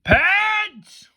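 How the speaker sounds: high, drawn-out summoning yell; imperious headmistress holler; shouting. A narrator's reading. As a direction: shout the name from a high window. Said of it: "poked her head out and shouted".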